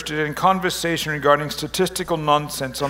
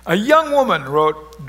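usual speaking voice

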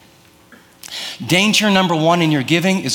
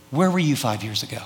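normal talking voice

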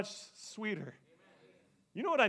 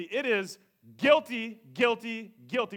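emphatic preaching; 4 semitones higher, 7 dB louder, slower than usual. Real, not acted.

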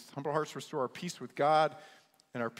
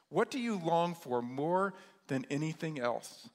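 normal talking voice

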